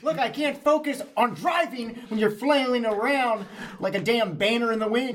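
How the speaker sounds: exasperated character voice. Acted. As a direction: snap at him from the wheel. Driver character complains mid-fight.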